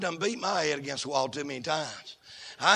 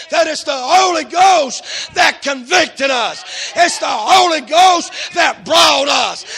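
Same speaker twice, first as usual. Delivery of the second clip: loud, higher preaching, slow on Holy Ghost; preaching at full force; 8 semitones higher, 15 dB louder, slower than usual. Acted. Preacher shouting to the congregation about the Holy Ghost. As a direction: hammer each line about the Holy Ghost.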